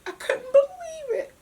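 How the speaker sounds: real crying. Real, not acted. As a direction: voice breaking into high tearful pitch while recounting. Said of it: "I'm crying now".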